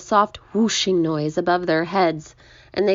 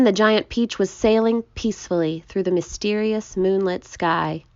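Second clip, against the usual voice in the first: plain reading voice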